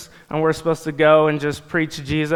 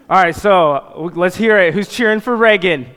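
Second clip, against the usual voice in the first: hype cheer; 6 semitones higher, 5 dB louder than usual. Real, not acted. Hyping the crowd for contestants.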